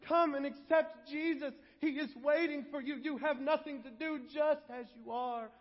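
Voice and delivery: high, pleading altar-call preacher; hammed-up, insistent altar-call plea; mock begging. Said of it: "beg them to come forward".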